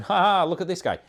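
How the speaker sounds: high, loud mocking laugh-along; mock jeering. YouTuber denying he picks out commenters to ridicule, mock jeering. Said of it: "not just picking this out and going".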